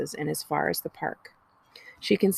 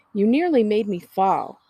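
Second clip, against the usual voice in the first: louder, indignant complaint; Tally accusing her sister from the roof; 4 semitones higher, 7 dB louder than usual. A narrator's reading. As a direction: throw the blame at her sister. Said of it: "glares at Nell accusingly".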